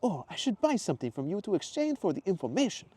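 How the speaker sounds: excited tourist in a put-on accent; Mateo's accent. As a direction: put on Mateo's foreign lilt. Mateo voiced.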